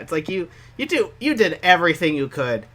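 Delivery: ordinary delivery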